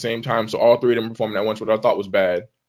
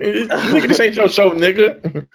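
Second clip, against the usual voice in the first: laughing; 8 semitones higher, 3 dB louder than usual. Real, not acted. Laughing, teasing co-host.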